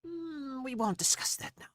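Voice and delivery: high-pitched gnome brushing off a question; evasive gnome. Acted. Gnome promising Ramirez a reward, high and evasive.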